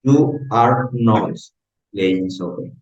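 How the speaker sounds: English read slowly, word by word; slow English model reading. Shown as a demonstration. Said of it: "mi pronunciación de mi estructura". (leído despacio, palabra por palabra)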